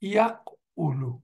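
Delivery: ইয়াকউলু drawn out slowly, each syllable clear; slow ইয়াকউলু, yak-oo-loo; careful pronunciation. Shown as a demonstration. Teacher modelling the present tense, slow ইয়াকউলু. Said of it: "আমরা বলতাম".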